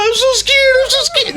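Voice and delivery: high squeaky imitation, laughing; mock scared girl. Imitating his girlfriend to the cop, mock scared.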